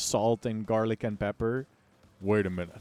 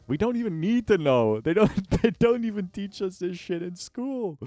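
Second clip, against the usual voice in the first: laughing rant; 9 semitones higher, 6 dB louder than usual. Real, not acted. Laughing rant about politics.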